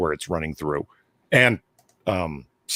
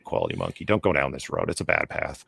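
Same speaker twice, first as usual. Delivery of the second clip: much lower, fading to whisper; ominous warning; 13 semitones lower, 6 dB quieter than usual. Acted. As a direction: warn darkly, voice sinking. Gloomy warning to friend.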